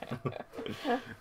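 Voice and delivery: a high giggling burst; high laugh. Real, not acted. Host laughs at her own muddled sentence, high giggle.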